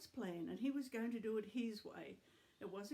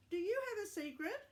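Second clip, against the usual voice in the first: grandma voice; 10 semitones higher, 4 dB louder than usual. Acted. Grandma puppet voice.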